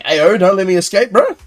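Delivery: rising startled protest; mock alarm. Streamer protesting to teammates holding him, mock alarm. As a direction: act thrown off and alarmed. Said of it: "I'm like".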